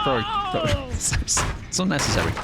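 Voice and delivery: usual speaking voice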